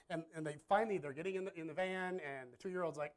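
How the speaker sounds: ordinary talk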